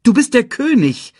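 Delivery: high, loud, emphatic insistence; contradicting. A narrator's reading. Nala reminds Simba of his rank, emphatic. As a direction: press the point home forcefully. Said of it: "widersprach Nala".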